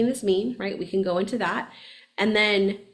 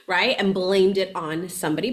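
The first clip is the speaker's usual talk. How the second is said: ordinary talk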